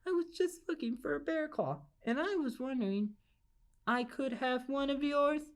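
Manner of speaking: a lightly voiced nervous old lady; timid old lady. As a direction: ask meekly, hoping he says yes. Timid lady asks the bear.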